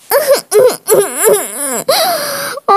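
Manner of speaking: a short, broken sob; sobbing. Acted. Little sister sobbing over her broken tablet.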